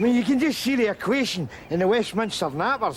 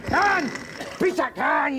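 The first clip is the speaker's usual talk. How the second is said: angry shout; 6 semitones higher than usual. Acted. Chasing soldier off.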